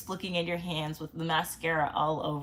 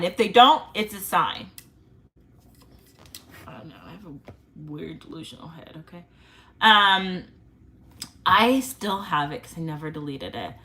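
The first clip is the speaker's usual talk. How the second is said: ordinary talk, nothing marked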